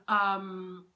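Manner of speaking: normal talking voice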